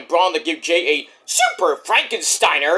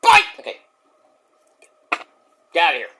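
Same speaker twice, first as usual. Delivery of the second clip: ordinary talk, nothing marked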